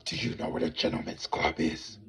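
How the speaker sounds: low, whispered, odd stranger voice; reptilian man's voice. Retold or imitated. Reptilian man on the bus asks directions, low creepy whisper. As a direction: rasp the question low and creepy. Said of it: "That's, that's how his voice sound".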